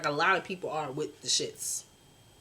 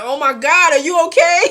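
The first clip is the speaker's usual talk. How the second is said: higher, much louder frantic mother; worried mom; 9 semitones higher, 14 dB louder than usual. Retold or imitated. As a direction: panic like a fussing mama on the phone. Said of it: "she gonna call you and be like".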